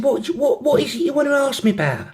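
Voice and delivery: very high put-on London accent. London girl asking her friend what she wants, London accent. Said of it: "have a go at the accents".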